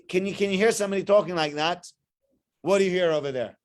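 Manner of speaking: ordinary talk, nothing marked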